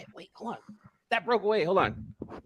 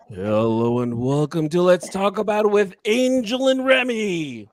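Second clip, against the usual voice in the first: announcer intro; 6 semitones higher, 4 dB louder than usual. Shown as a demonstration. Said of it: "I need direction".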